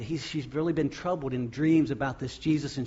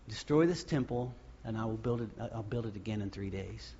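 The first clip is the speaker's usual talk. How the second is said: hushed Jesus quote; 4 semitones lower, 7 dB quieter than usual. Acted. Quoting Jesus' words, hushed.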